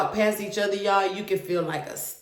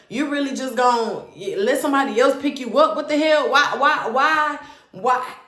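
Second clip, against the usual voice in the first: higher, louder exasperated why; angry pleading; 6 semitones higher, 4 dB louder than usual. Retold or imitated. Woman confronting her boyfriend over the other girl, exasperated. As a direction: plead and accuse at once. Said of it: "I'm like".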